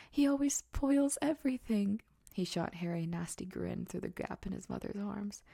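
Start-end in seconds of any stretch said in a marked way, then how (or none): 0.1-2.0 much higher and louder whiny wail; pretend sobbing yell. A narrator's reading. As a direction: wail it like a spoiled brat faking tears. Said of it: "yelled between huge pretend sobs".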